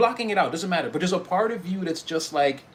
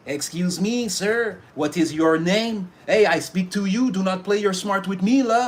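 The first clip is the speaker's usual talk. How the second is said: mock stern Montreal cop with a French-Canadian accent, louder; Montreal police accent; 5 semitones higher, 6 dB louder than usual. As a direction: imitate a Québécois officer questioning you sternly. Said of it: "that Montreal police accent".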